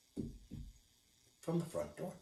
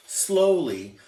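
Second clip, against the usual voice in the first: ordinary delivery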